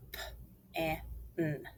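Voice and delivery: "P-A-N" as letter sounds, not letter names; three separate sounds with gaps; sounded out. Shown as a demonstration. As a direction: sound out pan softly, one phoneme at a time. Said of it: "Let's finger spell".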